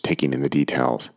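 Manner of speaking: plain reading voice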